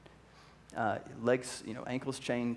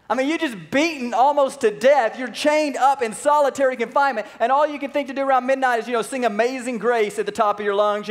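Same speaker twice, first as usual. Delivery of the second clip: high, loud, driving preacher voice; full-force preaching; 11 semitones higher, 9 dB louder than usual. Acted. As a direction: preach it hard to the congregation.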